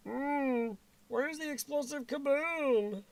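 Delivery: imperfect cartoon character impression; failed cartoon voice. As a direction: draw it out in a put-on cartoon voice. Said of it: "Didn't even get that right".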